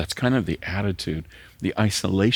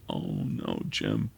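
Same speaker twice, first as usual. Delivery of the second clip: a hint of the widow's tearful voice, lower and softer; 11 semitones lower, 5 dB quieter, slower than usual. Widow comforting the pastor after the funeral, lightly voiced, tearful. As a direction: lightly voice her gentle, teary reply. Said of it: "with tears in her eyes".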